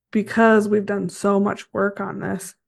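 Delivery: normal talking voice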